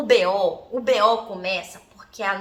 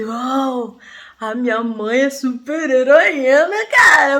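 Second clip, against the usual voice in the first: awed wonder; 9 dB louder, much slower than usual. Retold or imitated. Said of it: "ou se eu ficava assim".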